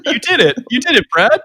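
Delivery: mock cheer. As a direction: cheer him on in jest.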